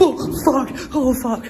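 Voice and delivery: high, loud, gasping panic; real terror, yelping out; panicked cries. Real, not acted. Ghost hunter panics after an object moves on its own. Something moved by itself.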